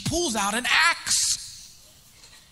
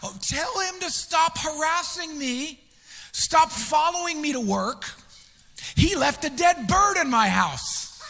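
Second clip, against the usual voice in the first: high, louder accusing cry; mock yell; 6 semitones higher, 4 dB louder, slower than usual. Woman accusing the pastor outside his office, high mock yell. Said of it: "she would just scream this".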